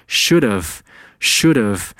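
"should've" slowly, as one word without h; slow demo of "should've", have reduced to -uv; linked, H dropped. Shown as a demonstration. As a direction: run "should've" together like "shoulduv". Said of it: "should和have连读成".

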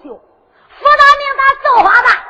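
loud, high, drawn-out boast; defiant boast. Acted. Captive Liu Xiu boasts to Su Long, defiant shout. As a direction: proclaim it high and slow, full of defiance.

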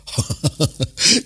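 short rueful chuckle, half amused; helpless laugh. A narrator's reading. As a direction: laugh despite himself. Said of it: "哭笑不得地说道".

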